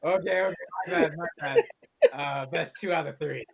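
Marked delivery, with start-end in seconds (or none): 0.0-1.6 sheepish monster voice. Acted. Dungeon monster apologizing.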